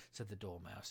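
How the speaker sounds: plain reading voice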